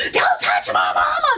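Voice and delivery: high-pitched yell of outrage; protective shout. Retold or imitated. Daughter rushing to defend her mama, high shout. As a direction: shriek it like a daughter charging in.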